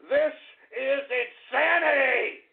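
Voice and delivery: slow, drawn-out word by word; emphatic, incredulous declaration; exasperated outcry. Real, not acted. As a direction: stretch out the last word in outrage.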